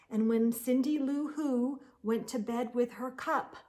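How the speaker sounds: ordinary delivery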